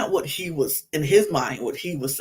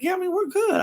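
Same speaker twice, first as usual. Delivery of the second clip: a lightly higher casual reply; breezy Connor; much faster than usual. Retold or imitated. Connor casually reassures Janae, lightly higher and breezy. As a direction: toss it off easily. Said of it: "And he's like".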